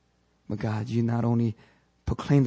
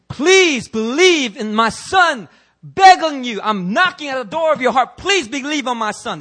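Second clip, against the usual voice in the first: mock begging God; 12 semitones higher, 11 dB louder than usual. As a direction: ham up a God who begs. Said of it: "He didn't say".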